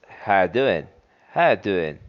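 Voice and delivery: How you doing with the are dropped, How said as ha; casual How you doing, run together; reduced form. Shown as a demonstration. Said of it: "That way is".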